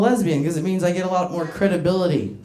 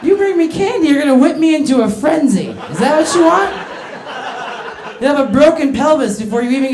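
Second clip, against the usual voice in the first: mock threatening; 6 semitones higher, 5 dB louder than usual. Warning a small man.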